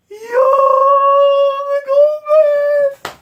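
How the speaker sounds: high, loud, drawn-out disbelief; overwhelmed outcry. Real, not acted. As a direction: stretch out a stunned, high-pitched yell. Overwhelmed reaction to manga moment.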